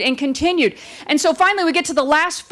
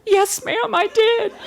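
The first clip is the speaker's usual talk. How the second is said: sheepish photographer; 8 semitones higher, slower than usual. Retold or imitated.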